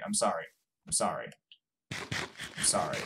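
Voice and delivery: low, soft, fading sorry; subdued apology. Real, not acted. Player apologizing after admitting they're right, subdued. Repeated apology, dropping away.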